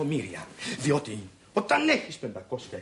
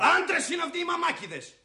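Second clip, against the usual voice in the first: much higher, scornful outburst; indignant shout. Acted. Husband yelling at wife about useless men, scornful shout. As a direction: yell it with contempt for mama's boys. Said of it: "Καλά, μη φωνάζεις".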